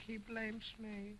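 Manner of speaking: muttered aside. Acted. Dummy repeats his complaint under breath.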